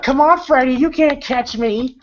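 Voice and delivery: high, teasing taunt at the killer; mock him like a cocky kid. Nancy taunting Freddy from the stairs, teasing. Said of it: "she's like".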